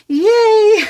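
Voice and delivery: ordinary talk, nothing marked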